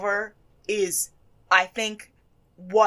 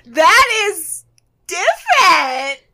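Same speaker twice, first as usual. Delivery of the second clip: high, loud, slow shocked cry; shocked exclaim; 9 semitones higher, 10 dB louder, much slower than usual. Retold or imitated. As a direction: blurt it out in shock. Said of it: "she goes".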